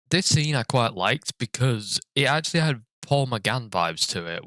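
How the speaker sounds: ordinary delivery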